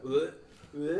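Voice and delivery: imitated dry-heave "bleh"; mock retching. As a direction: fake gagging, short and punchy. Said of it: "basically making themselves throw up".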